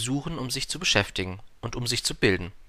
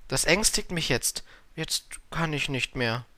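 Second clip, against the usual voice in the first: higher, hesitant little prince; stammelnder Prinz; 5 semitones higher, slower than usual. A narrator's reading. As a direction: falter shyly, pitched up a little. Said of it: "stammelte der kleine Prinz und errötete". (zaghaft, mit leicht erhöhter Stimme)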